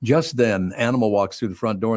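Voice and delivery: ordinary delivery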